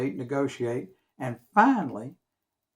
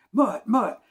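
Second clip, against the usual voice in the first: calling out; 7 semitones higher, 6 dB louder than usual. Retold or imitated. Calling at neighbour's door.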